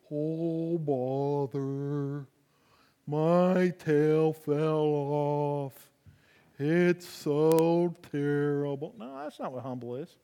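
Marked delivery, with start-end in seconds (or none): 0.1-8.9 slow, droopy, gloomy donkey moan; Eeyore. Acted. Preacher mocks Eeyore to show what humble isn't, in a mopey drawl. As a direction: drag every word like a sad stuffed donkey. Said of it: "not an Eeyore kind of a person".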